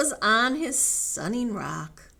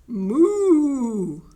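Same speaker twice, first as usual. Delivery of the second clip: loud, higher moo; cried-out moo; 5 semitones higher, 11 dB louder than usual. Acted. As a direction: cry out a big long moo. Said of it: "cried again".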